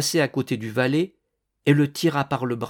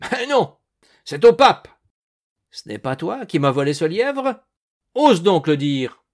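loud, high, sarcastic challenge; angry accusation; 6 semitones higher, 8 dB louder than usual. Acted. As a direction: challenge the thief at full voice. Hunter angrily accuses farmhand of theft.

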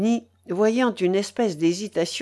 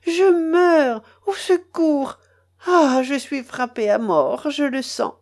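high, dramatic cry for help; acted anguish, pitched well up; desperate cry; 8 semitones higher, 6 dB louder than usual. A narrator's reading. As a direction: cry out as if struck to death. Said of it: "s'écria Mélanie".

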